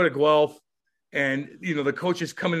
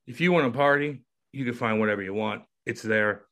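ordinary delivery

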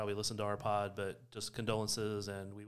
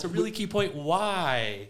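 laughing, louder and slower; laughing emphasis; 8 semitones higher, 10 dB louder, slower than usual. Real, not acted. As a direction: burst out laughing while stressing the point. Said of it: "I'm feeling the love in this room".

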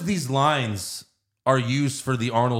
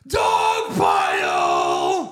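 very slow, louder drawn-out yell; yelled dog pile; 9 semitones lower, 4 dB louder, much slower than usual. Retold or imitated. Friend mocks Belushi yelling in the movie, slow drawn-out yell. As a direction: drag the yell out lamely. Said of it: "Belushi yells".

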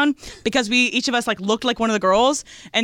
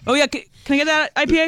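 high, fast eager bar order; IPA guy impression; 6 semitones higher, faster than usual. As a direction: rush the order like a beer bro. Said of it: "that's my I P A guy impression".

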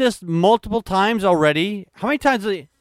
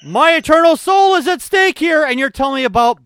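raised, higher mock indignation; loud, pitched-up protest; mock outrage; 9 semitones higher, 6 dB louder than usual.

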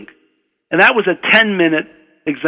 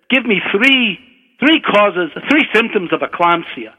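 lightly voiced Scottish examiner, starting higher and settling; Scottish accent; 4 semitones higher than usual. Professor quizzes the student, light Scottish accent. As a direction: put the exam question with a slight Scottish accent. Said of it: "try to do my Scottish accent".

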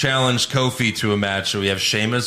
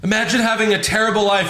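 ordinary delivery

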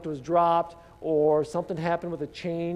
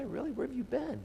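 incredulous; 7 semitones higher, 9 dB quieter than usual. Retold or imitated. Mocking the clueless stranger.

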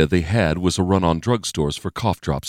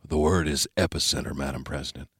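lower, softer male voice; grim advisor; 3 semitones lower, 8 dB quieter than usual. A narrator's reading. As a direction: deliver bad news low. Murray answers grimly.

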